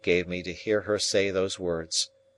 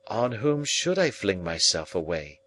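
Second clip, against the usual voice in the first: plain reading voice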